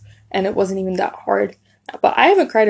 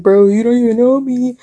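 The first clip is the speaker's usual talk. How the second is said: retold confrontation; 4 semitones higher, 5 dB louder than usual. Ander confronts Omar in show.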